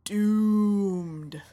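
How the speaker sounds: long drawn-out cry of doom; melodramatic doom. Acted. Passenger despairs of incompetent captain saving them, melodramatic. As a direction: stretch the one word into a sinking lament. Dramatically declares them doomed.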